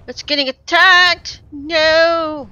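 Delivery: high panicked yelling; alarmed shout. Real, not acted. Pet attacked in game.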